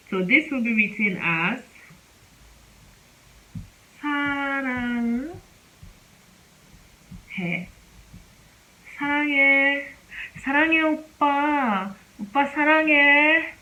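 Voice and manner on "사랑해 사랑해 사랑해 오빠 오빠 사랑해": high, excited fangirl squeals of love; high, fast fan-girl gushing. Acted. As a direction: gush like a fan screaming for her idol. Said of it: "this is just me fangirling".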